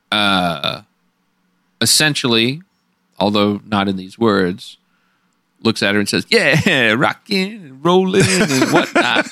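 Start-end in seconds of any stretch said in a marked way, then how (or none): 6.3-9.3 slow, laughing, higher mock greaser drawl; Danny Zuko impression. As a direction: play it like a smug fifties greaser, laughing. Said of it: "he does a cool Danny Zuko to her".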